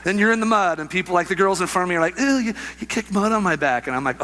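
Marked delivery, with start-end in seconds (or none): 2.2-3.9 higher-pitched mock disgust; put-on high, squeamish protest; squeamish complaint. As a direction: whine like a grossed-out girl, imitated. Mimicking complaining girls in the mud.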